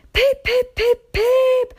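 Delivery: very high, repeated insistent whistle; anxious whistling. A narrator's reading. Edward whistling impatiently for the late guard. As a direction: toot over and over, impatient. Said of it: "Edward was getting anxious".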